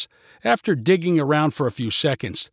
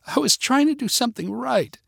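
high-pitched, hurt whine; pouting; 11 semitones higher than usual. A narrator's reading. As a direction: protest through a pout. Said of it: "she said with a pout".

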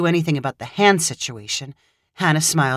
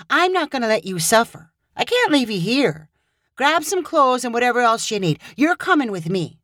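higher, firm and caring; determined Hannah; 6 semitones higher than usual. Acted. Hannah insisting Caleb come with her, determined. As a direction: take charge kindly.

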